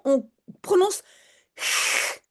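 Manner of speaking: ch said as a long breathy rasp in the throat; sound demo. Shown as a demonstration. Teacher showing francophone learners the Dutch ch sound, throaty demo. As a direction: hiss out the ch of verschrikkelijk. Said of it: "on prononce le S".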